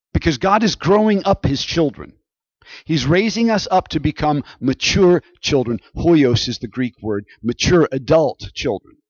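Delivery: loud, high preacher's proclamation; preaching at full force. Acted. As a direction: proclaim it to the whole congregation. Preaching climax on growing up.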